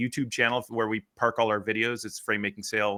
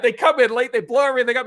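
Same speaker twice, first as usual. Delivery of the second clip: high, loud retold rant; worked-up mock yelling; going off on her; 13 semitones higher, 8 dB louder than usual. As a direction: imitate a man losing it at a colleague. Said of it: "he just went off on her".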